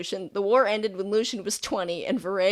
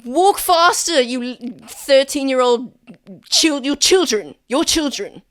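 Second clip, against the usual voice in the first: loud, higher, irritated scolding; pissed-off prefect; 5 semitones higher, 8 dB louder than usual. Acted. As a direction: scold like an annoyed teenager stuck babysitting. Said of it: "he's pissed off about it".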